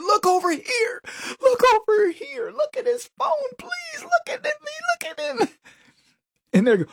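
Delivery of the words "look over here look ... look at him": much higher, whiny crying plea; mock pleading wife. Host mimics the wife begging people to look at his phone. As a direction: wail it up high like a desperate wife.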